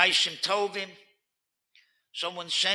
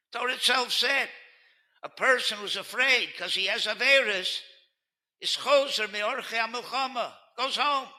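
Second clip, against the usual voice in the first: loud, rising preacher's build; impassioned preaching, higher and louder; rousing sermon; 7 semitones higher, 4 dB louder than usual. Acted. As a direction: stir the congregation with each word. Continues rousing exhortation.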